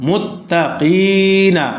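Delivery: very slow মুত্তাকিনা, "ee" in কি held long; chanted মুত্তাকিনা with a lengthened middle vowel; madd stretch. Shown as a demonstration. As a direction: stretch the কি of মুত্তাকিনা, then a short না. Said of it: "মাদের হরফ এক আলিফ টান হবে".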